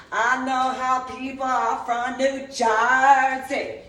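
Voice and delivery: loud sing-song mock, dragged out slow; exaggerated taunting drawl. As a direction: sing it out like a mocking tease. Mocking a schoolmate's claim.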